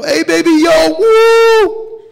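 very high, loud whooping catcall; rowdy street catcall yell; catcalling guys. Retold or imitated. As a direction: holler and whoop like guys in a passing car. Guys catcalling from honking car.

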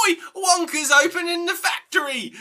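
very high-pitched mock kid holler; excited kid. Little kid bursting into class with news, high excited holler. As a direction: squeal out the big news as a child.

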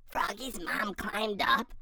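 plain reading voice

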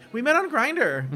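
a giddy friend mock-gushing about a quick romance; gushing quote. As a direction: gush the news like it's wonderful. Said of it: "the gays who are like".

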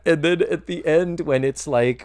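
ordinary talk

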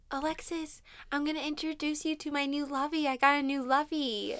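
put-on high, quick childlike voice; imitating Hazel. Hazel introducing her new lovey, high excited childlike voice. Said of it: "she was like".

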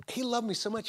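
ordinary talk, nothing marked

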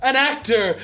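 mock theatrical boast about being an actor; mock grandeur. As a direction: proclaim yourself a great actor.

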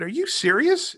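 high, loud retold disbelief; stunned disbelief. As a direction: replay the moment you couldn't believe your ears. Stunned by a huge offer.

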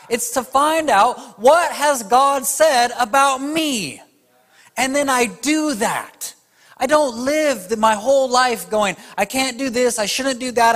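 high, loud impassioned sermon; full-force preaching. Acted. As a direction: preach it hard to the crowd.

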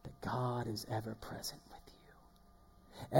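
slow whisper; soft hushed whisper. Real, not acted. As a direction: whisper it as a secret comfort. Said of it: "whispered reflection".

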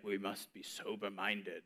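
low, slow, solemn mock piety; pious voice. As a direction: intone it like a stern deacon. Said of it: "And it's like".

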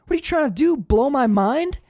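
high, loud, the end slowed right down; overwhelmed by speed; thrilled disbelief. Retold or imitated. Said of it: "he goes".

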